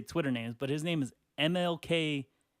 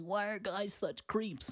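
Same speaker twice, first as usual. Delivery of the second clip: high, slow mocking imitation; mock ranting porn star; 6 semitones higher, 3 dB quieter, slower than usual. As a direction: imitate a complainer, drawn out and high. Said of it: "they go on their rants".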